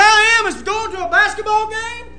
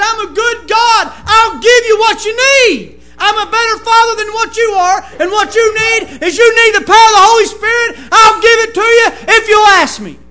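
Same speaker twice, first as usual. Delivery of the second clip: preaching at full force; 2 semitones higher, 10 dB louder than usual. Acted. Preacher voicing God's promise, shouting. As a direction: thunder God's promise to the church.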